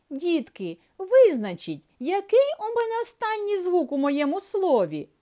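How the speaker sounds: put-on high, bright-eyed owl asking the children; owl's voice. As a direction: sing it up high like a little owl. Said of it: "Вона попросила". (високим голосом сови)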